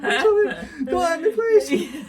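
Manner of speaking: high, slow, laughing fake plea; mock begging. Player pretending to fear teammates, laughing plea. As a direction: whimper for mercy through laughter.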